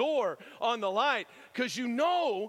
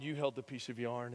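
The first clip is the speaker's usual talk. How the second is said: ordinary talk, nothing marked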